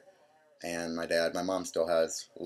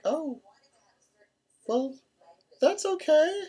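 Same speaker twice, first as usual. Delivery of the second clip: much higher, louder mock mom voice; imitating mom; 6 dB louder, slower than usual. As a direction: chirp it high and forced-cheerful like mom. Mom voiced after coming out.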